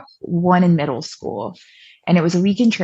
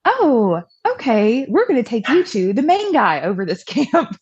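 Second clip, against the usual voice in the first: higher-pitched, lightly imitated camp lady; mock soothing, pitched up high; placating youth leader; 6 semitones higher than usual. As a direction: imitate a youth leader handling an upset kid. Youth lady placating sobbing child.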